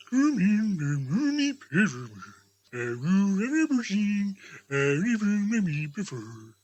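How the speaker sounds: a booming jovial giant welcoming a guest; jolly giant spirit. Acted. Spirit greets Scrooge.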